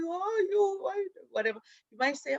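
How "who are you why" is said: much higher, indignant snap back; mock defiant retort. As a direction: throw the question back at them. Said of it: "Instead of you going".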